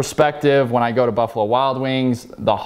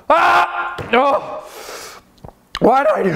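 pained outburst; slower than usual. Real, not acted. Burning mouth from the pepper.

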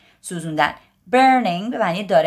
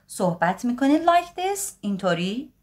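ordinary talk, nothing marked

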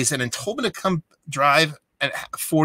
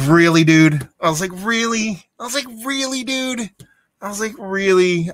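exasperated disbelief; 8 semitones higher, 4 dB louder than usual. Retold or imitated. Exasperated at FedEx counter.

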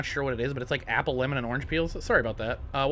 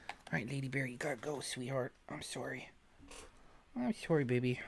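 tender to the cat; 12 dB quieter than usual. Real, not acted. Sending the cat away softly.

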